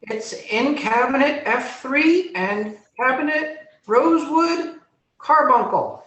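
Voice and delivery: high, loud creature voice; talking tumor. Acted. Tumor tells the gnome where potions are, high creature voice. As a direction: call out the location in a high-pitched squeal.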